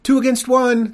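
very high, loud shriek; shrieking princess. A narrator's reading. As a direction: shriek it in shrill triumph. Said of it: "the princess shrieked".

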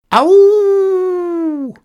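a huge, high howl of a dog; imitated yowl. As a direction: throw your head back and yowl like the beast. Trapper imitates the yowling dog.

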